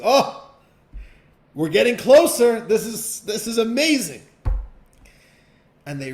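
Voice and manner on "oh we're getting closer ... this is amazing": high, loud, thrilled squeal; overjoyed to be getting closer; excited pike. Acted. Pike hearing it will meet the king, high thrilled squeal. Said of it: "and the pike says".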